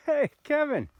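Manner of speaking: high, loud delighted greeting; retold happy greeting. Rider recognising his friend Kevin, high delighted greeting. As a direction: greet an old friend you just recognised. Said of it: "I go".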